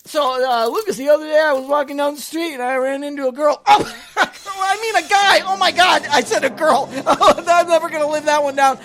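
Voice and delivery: loud, high, laughing through the slip; mock embarrassed blooper. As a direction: overplay the flub and crack up.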